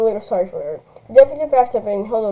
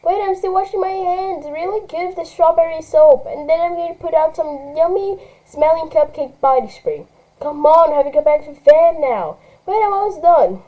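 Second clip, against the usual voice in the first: put-on high, sing-song little kid voice; little girl; 5 semitones higher than usual. Little sister answering from the bathroom, high and childish. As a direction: play the carefree little sister.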